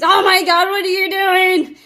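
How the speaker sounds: high, loud shriek at the dog; the overreaction to avoid, a loud high yell; the wrong way, yelling. Shown as a demonstration. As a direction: scream it like you caught them red-handed. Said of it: "I don't want you to yell at these poor things".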